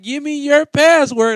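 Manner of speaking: loud, high, slow mock robber; mock robber demand. Comic retelling the robbery bit, loud high robber voice. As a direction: bark the demand like a stickup man. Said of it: "he'll be like".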